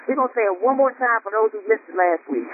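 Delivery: ordinary talk, nothing marked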